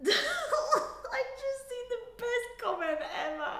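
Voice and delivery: high, loud laughing delivery. Real, not acted. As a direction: speak through a burst of laughter. Laughs reading a funny comment.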